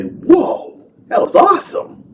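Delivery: high, awed gasp of delight; amazed whoa. Retold or imitated. Fan gushing over the scene in awe. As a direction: gush like a wowed kid.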